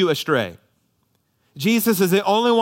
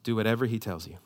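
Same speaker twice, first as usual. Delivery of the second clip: soft, hushed; 6 semitones lower, 8 dB quieter, faster than usual. Shown as a demonstration. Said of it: "tuck this line ... into your heart".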